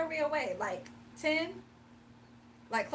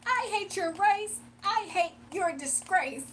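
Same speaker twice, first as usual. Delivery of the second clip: slow, high, emphatic mock insults; mock hateful rant; 5 semitones higher, slower than usual. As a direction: play an over-the-top hater, drawing out every jab. Acting out the hater's insults.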